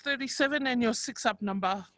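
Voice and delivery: ordinary talk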